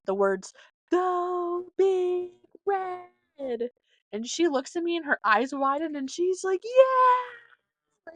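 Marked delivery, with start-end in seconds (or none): 0.9-3.7 mouthed silently and very slowly. Retold or imitated. Mouthing a cheer to a stranger at a stoplight, drawn out. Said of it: "I mouthed the word". 6.6-8.1 excited shout. Retold or imitated. Stranger cheering back at stoplight.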